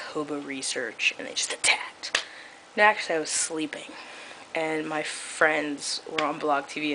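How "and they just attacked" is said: whispered like a spooky story; hushed mock drama. Telling her viewers about the attack, whispering. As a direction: drop to a hushed whisper for effect.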